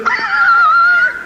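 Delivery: very high, loud mock shriek of the driver; imitated scream. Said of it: "the guy driving the car said".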